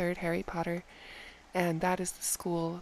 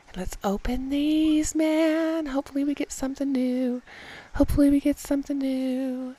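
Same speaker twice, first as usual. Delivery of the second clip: eager hope; 5 semitones higher, 5 dB louder than usual. Real, not acted. Hoping for new item.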